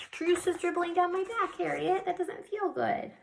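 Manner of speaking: high-pitched put-upon dog voice; dog's complaint. Acted. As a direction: gripe in a high voice as the soaked dog. Voices the dog dripped with juice.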